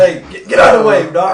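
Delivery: shouted high and loud at the doctor; hammed-up shout to get out of the way; mock shout. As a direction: yell it like a ref clearing the ring. Mocking fight ref shout.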